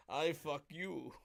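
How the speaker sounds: put-on slow, soft Yakov-style Russian accent; mock Russian accent. Co-host finishing the Soviet joke, slow mock Russian accent. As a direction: deliver the Russian-reversal punchline slowly in a Russian accent. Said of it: "I love doing a Russian accent".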